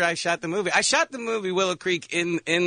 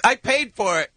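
put-on defensive teen; 7 semitones higher, 4 dB louder than usual.

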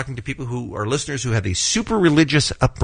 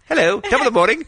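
hammed-up British greeting, pitched up; a quick joking stab at a British accent; mock British accent; 11 semitones higher than usual. Host imagining the co-host suddenly British, mock British accent. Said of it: "Wouldn't it be so cool if you walked in today".

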